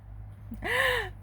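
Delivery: high laugh. Real, not acted. Laughing at the memory.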